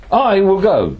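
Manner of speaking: "I" punched high and loud; stress on I. Shown as a demonstration. Teacher showing emphasis by tone, "I" stressed high. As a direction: lean hard on the word "I", then drop. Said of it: "I can emphasize with tone of voice".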